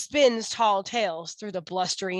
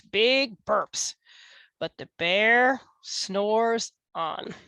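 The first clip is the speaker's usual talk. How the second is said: ordinary delivery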